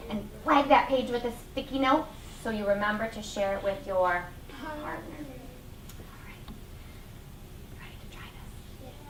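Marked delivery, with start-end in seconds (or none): 5.2-8.9 whisper. Real, not acted. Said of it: "whispered aside".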